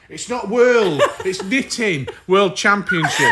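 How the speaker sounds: high, slow, laughing; Knitting World Championship, laughed out; laughing correction. Real, not acted. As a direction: correct her while cracking up. Correcting her while laughing.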